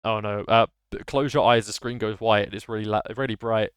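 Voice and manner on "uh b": ordinary delivery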